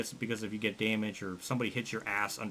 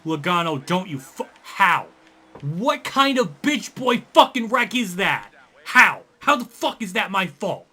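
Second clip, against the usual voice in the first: loud, high-pitched rage at another driver; enraged outburst; 8 semitones higher, 10 dB louder, slower than usual. Real, not acted. Gamer screaming at Logano after being blamed for a wreck, enraged. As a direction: explode in disbelief, stretching the angry words. Rages at crash in game.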